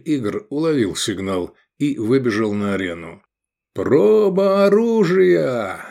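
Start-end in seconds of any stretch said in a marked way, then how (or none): 3.7-5.9 slow, high, ringing announcement; loud proclamation. A narrator's reading. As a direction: proclaim it to the arena. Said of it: "громко возвестил он".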